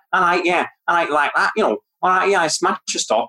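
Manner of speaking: imitated Mancunian accent; Manchester accent. Shown as a demonstration. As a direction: put on a rough Manchester accent like a local. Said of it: "up to Manchester".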